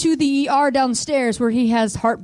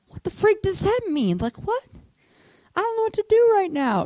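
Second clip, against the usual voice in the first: very high, whiny bewilderment; hammed-up helpless high whine; panicked confusion; 12 semitones higher than usual.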